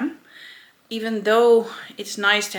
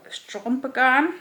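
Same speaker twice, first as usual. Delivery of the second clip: English accent; 6 semitones higher, 5 dB louder than usual. Shown as a demonstration. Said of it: "trying to do it in an English accent".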